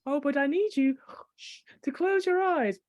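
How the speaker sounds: ordinary delivery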